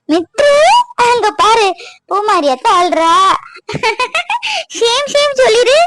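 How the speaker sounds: high-pitched mock shaming; playful teasing. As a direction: call the child over to tease the crier. Getting child to tease crying aunt. (உயர்ந்த குரலில் செல்லக் கேலியாக)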